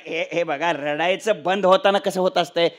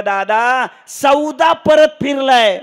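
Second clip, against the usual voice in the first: high, loud, drawn-out mock wail; tearful third son; 5 semitones higher, 10 dB louder, slower than usual. Third son tells father the sale fell through, loud wail. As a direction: wail the twist out slowly and loudly. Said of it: "तिसरं पोरग रडतच आलं".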